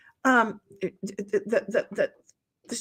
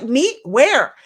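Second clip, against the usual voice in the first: mock surprise; 7 dB louder than usual. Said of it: "she's like".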